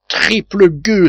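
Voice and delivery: high, slow, outraged curse from the sailor; sailor's furious cry; angry cry. Acted. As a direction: spit out the insult at the thieves. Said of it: "s'écria le marin".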